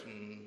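deep quiet knowing mm; low guilty hum. Retold or imitated. Staffer caught by the camp director, guilty low hum. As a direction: hum like you know you're caught. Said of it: "he just right away goes".

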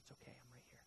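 very soft, low bedside reassurance; soothing father. Retold or imitated. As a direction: hush a frightened child to sleep. Father soothing his crying child.

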